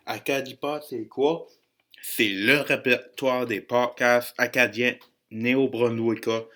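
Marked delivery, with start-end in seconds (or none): none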